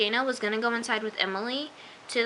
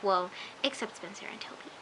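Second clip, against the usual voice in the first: ordinary talk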